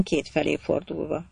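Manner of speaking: usual speaking voice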